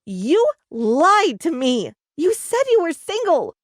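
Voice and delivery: loud angry yell, rising high. A narrator's reading. Teacher yells at her lying boyfriend, loud and high. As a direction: hurl the accusation at him. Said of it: "yelled Miss Barry".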